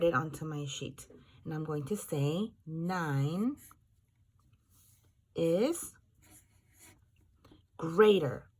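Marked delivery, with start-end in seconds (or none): 1.4-8.5 words spaced out as you write them; slow dictation. Shown as a demonstration. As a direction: dictate slowly while writing. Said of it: "underline the word greater".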